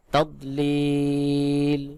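তদলিল with the final ee held very long; slow recited তদলিল, long steady last syllable; correct elongated pronunciation. Shown as a demonstration. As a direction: stretch the লি of তদলিল for three alif counts before closing on l. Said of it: "সহি উচ্চারণ হবে".

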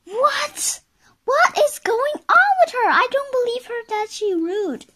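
very high, fast, incredulous outburst; outraged onlooker. Acted. Onlooker reacts to Miss Bossy's rudeness, high and outraged. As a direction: squeal the disbelief, loud and rapid. Outraged aside about the character.

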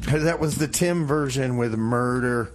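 ordinary talk, nothing marked

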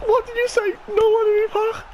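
high, loud, breathless excitement; shrieking jackpot winner. Real, not acted. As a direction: still yelling from the pull. Screaming to friend.

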